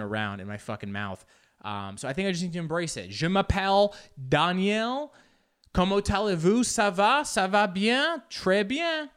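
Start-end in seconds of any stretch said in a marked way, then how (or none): 3.1-9.1 high-pitched, overeager French accent; showy learner's French, straining to sound native; trying-too-hard French accent. Shown as a demonstration. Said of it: "Then I just sound like I'm trying".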